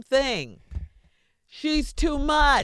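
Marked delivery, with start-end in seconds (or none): 1.5-2.6 slow, emphatic "too much", pitched up; mock drawn-out verdict. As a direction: say it like the guys complaining. Guys' verdict voiced slowly.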